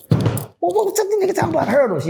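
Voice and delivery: high, fast quizzical voice; mock confused listener. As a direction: play the puzzled heckler quickly. Voicing imagined listener.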